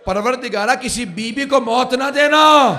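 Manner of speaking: a mourner's lament building to a cry; rising lament. Acted. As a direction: pour the prayer out in rising grief. Imam Husain's prayer, climax.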